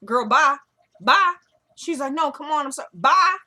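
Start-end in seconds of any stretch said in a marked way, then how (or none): 0.1-1.3 loud, sassy send-off, rising higher; dismissive retold shout. 2.1-3.4 high plea cut off by a loud "bye"; acted plea and dismissal.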